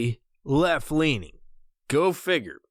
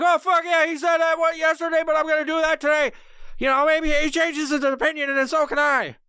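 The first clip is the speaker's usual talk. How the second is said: mock gushing fan; 11 semitones higher than usual. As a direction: gush like a blind follower. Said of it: "be like".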